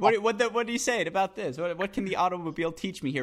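half laughing while voicing a fan. Real, not acted. Imagined fan asking for a joke, laughing.

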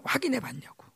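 a sly, breathless whispered question; whispered aside. Real, not acted. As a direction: whisper it as a wry jab. Sarcastic aside about welcoming Spirit. (비꼬듯 속삭이는 말)

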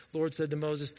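ordinary talk, nothing marked

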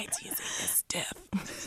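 slow, breathy secret whisper; leaning in to whisper. Shown as a demonstration. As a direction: lean in, hush it slowly. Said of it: "your A S M R voice".

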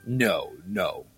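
ordinary delivery

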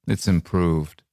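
understated, matter-of-fact reply; laconic. Acted. Corporal answers about London, laconic. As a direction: drop it as a laconic verdict, falling at the end.

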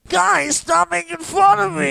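high, slow whining shout; whiny shout. Acted. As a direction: wail it like a hurt kid. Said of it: "I like your interpretation".

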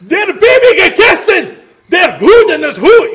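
high, loud fake German barking; mock German shouting. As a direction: yell gibberish German like a cartoon Nazi. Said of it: "I'd be like".

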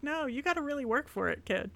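mock Gritty taunting a small child in goal; mascot voicing Gritty. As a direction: tease the kid as a cocky mascot. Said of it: "Gritty goes up against a small child and was like".

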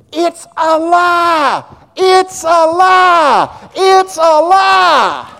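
high, slow, full-throated shout; hollering. Acted. Jesus answering the enemy's lies, hollering loud. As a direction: roar it out over the enemy's lies. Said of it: "Jesus Christ is hollering".

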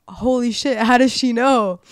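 drawn-out, loud replay of being stunned; retold astonishment. Newcomer caught out on a fake name, astonished. Retelling shock at being found out.

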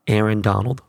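ordinary talk, nothing marked

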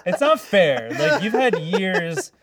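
laughing, high and loud mock complaint; mock protest. Host losing a pun contest to guests, laughing mock protest.

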